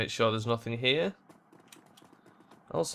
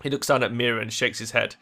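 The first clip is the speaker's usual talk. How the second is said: normal talking voice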